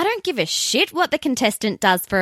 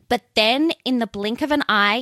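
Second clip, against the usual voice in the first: ordinary talk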